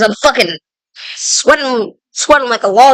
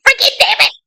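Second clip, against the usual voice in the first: higher, squeaky mock outburst; mock frustrated cry; 12 semitones higher, 4 dB quieter, faster than usual. Imagining being lost in the woods, squeaky mock yell. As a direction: play yourself getting lost, exasperated. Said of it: "I'd be like".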